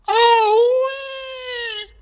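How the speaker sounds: squealing ouch, very high; high pained squeal; high yelp of pain. Acted. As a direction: yelp like a hurt crocodile. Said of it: "cried the crocodile".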